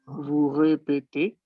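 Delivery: slow vous répétez with both E closed; slow French model. Shown as a demonstration. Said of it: "las dos son iguales". (vous répétez lento, E cerradas)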